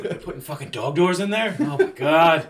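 mock horror at dog doors; shocked outrage. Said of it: "It's like".

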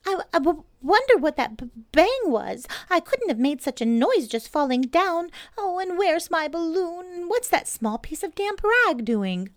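high, loud stuttering little pig; stammering Piglet. Acted. Piglet confused after a fall, high stammer. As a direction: stutter in a tiny squeak.

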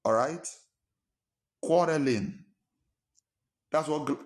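ordinary delivery

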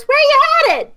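put-on high, bright child voice; kid's voice. Rickshaw kid jogs over, asks in a high kid voice. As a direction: ask it like an eager street kid.

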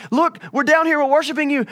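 high, loud pleading to a silent god; desperate crying out. Retold or imitated. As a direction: shout it up high, begging to be noticed. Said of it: "And they're like".

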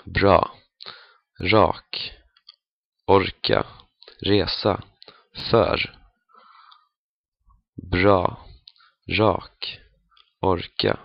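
Bra, rak, orka, resa, för with a soft buzzed R; gentle fricative R, near zh, in Bra, rak, orka, resa, för. Shown as a demonstration. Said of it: "a bit softer than the French J".

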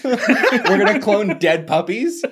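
slow, high laughing voice. Real, not acted. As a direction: laugh it out slowly in disbelief. Laughing at dark joke.

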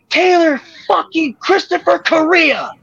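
high, loud, drawn-out furious yell of his full name; wife spotting him in the ring, yelling his full name. Retold or imitated. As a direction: scream his whole name like an enraged wife across the building. Angry wife yells his name.

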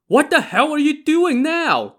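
high, loud exasperated shout. A narrator's reading. Daizong shouts at his companion after the soup is thrown, exasperated shout. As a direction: shout it at him, pitch shooting way up. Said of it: "Daizong shouted at him".

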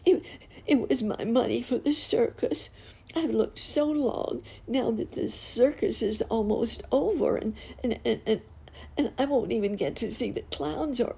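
put-on high, stammering tearful child; acted little-girl wail through sobs; sobbing harder. A narrator's reading. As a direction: blubber it out high, tripping on words. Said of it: "she sobbed harder than ever".

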